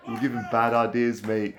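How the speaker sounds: usual speaking voice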